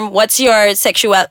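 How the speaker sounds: normal talking voice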